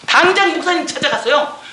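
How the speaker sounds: ordinary talk, nothing marked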